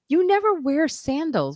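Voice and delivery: lightly mock the surprised friend; imitating a friend. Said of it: "someone even said to me".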